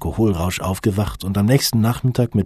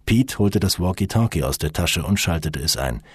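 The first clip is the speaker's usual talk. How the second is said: ordinary delivery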